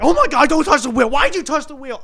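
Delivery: imitated high, loud panicked yelling; panicking mom. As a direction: shriek it like a mother jolted awake at the wheel. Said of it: "She's panicking".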